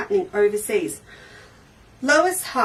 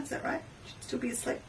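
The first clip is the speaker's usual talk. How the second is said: mock hush so as not to wake her; whisper; 2 semitones higher, 15 dB quieter, faster than usual. Whispering about a viewer still asleep in Spain. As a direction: whisper it as if she is asleep nearby.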